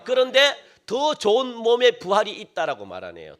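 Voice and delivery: ordinary talk, nothing marked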